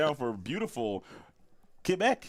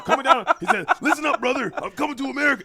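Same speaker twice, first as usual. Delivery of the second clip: loud, high wrestling promo voice; Sailor impression; 11 semitones higher, 9 dB louder, faster than usual. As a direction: yell it like a pumped-up wrestler. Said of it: "Just like that. That's exactly how he talks".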